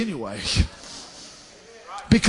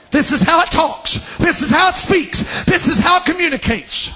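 high, driving repetition; insistent preaching; 8 semitones higher than usual. Real, not acted. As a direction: hammer each phrase home, pitched high. Preacher hammering a repeated point.

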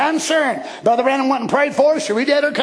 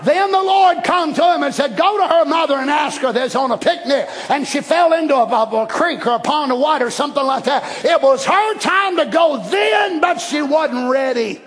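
fiery preaching; 4 semitones higher than usual. Acted. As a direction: preach it with full fire. Preaching at full force.

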